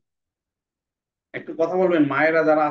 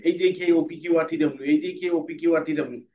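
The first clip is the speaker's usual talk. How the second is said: letters rattled off fast, over and over; speedy reading; faster than usual. Shown as a demonstration. Teacher drilling class at speed. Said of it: "পরে speedy করতে হবে".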